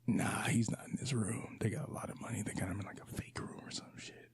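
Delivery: soft conspiratorial whisper. Retold or imitated. Viewer whispering a suspicion to a friend, hushed. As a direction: lean in and whisper the theory.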